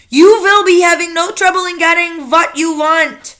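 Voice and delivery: lightly shouted witch leader. A narrator's reading. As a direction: assure them as the Grand High Witch. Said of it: "shouted gr-, the Grand High Witch".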